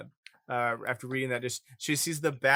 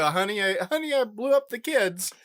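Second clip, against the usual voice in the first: loud, high, overwrought sitcom parent; hammed-up sitcom alarm; 7 semitones higher, 7 dB louder than usual. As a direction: wail in mock panic like a sitcom mom. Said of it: "like, a sitcom or something".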